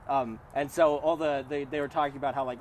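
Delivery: normal talking voice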